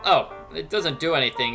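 ordinary talk

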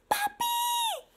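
very high, delighted squeal; overjoyed, high-pitched announcement for little kids; thrilled reveal. Acted. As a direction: squeal the big happy surprise. Reveals the perfect pet.

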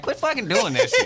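high-pitched, laughing mock protest; mock annoyance. Host imagining his reply to people copying his accent, mock annoyed. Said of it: "I'd be like".

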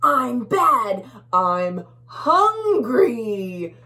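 loud growling boast, slowing down; bully bug. Acted. Bully bug boasting to tiny bugs, loud and menacing. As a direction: gloat like a hungry monster. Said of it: "says the big bad bully bug".

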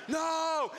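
high mock yell; shouted refusal. Man refusing the crowd's push, shouting. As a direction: cry it out in panicked refusal.

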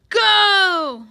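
huge, high drawn-out shout; roaring. A narrator's reading. Coach sending swimmers into the pool, roaring. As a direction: roar the start signal. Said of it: "roared the coach".

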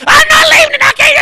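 very high screaming refusal; panicked wife refusing. Retold or imitated. Wife screaming she can't leave the snake, panicked. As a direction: shriek it like she won't budge.

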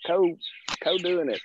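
high-pitched eager agreement, stumbling over "co-"; excited. Retold or imitated. Recalling day one reaction to Courtney's offer, excited. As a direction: re-enact jumping at the offer. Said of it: "we were excited".

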